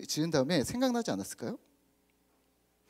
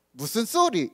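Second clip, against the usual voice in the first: higher-pitched mock Gyeongsang retort; lightly put-on Gyeongsang dialect; 6 semitones higher, slower than usual. Shown as a demonstration. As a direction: attempt a Gyeongsang accent, indignant question. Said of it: "경상도 사투리라고 생각하면".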